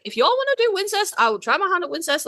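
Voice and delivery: a hint of a friend offering to help; mock gracious friend. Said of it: "B J very graciously was like".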